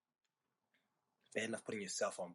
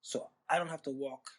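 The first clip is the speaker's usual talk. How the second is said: ordinary talk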